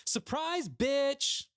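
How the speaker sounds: high gleeful surprise shout; excited yell. Acted. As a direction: burst in yelling with glee. Beth bursts in shouting.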